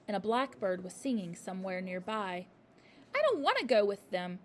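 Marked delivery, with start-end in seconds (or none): 3.2-4.4 loud defiant shout; a child refusing. A narrator's reading. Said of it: "Matilda shouted suddenly".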